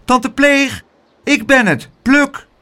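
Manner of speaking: high-pitched, carrying call. A narrator's reading. Pluk to his aunt through the letterbox, high calling. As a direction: call through a door to be let in. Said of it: "Hij riep door de brievenbus".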